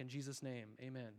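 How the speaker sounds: tossed off like a habit; mumbled amen tag; rote prayer ending. Retold or imitated. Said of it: "we tack on ... like".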